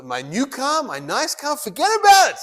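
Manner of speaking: rising to a high, loud exasperated burst; mock worry. Rabbi imagines owning a nice car, hammed-up worried outburst. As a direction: build up to a hammed-up overwhelmed cry. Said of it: "I'm gonna be so worried".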